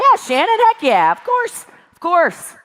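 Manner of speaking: high, loud, eager agreement; mock eager yes. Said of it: "We all want".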